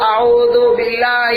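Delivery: اعوذ باللہ spelled out syllable by syllable, slow and loud; slow drawn-out drilling. Shown as a demonstration. Maulana showing a new pupil how to recite: slow, loud. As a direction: stretch اعوذ باللہ very slowly and loudly for a child to copy. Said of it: "اس کو بتاؤ".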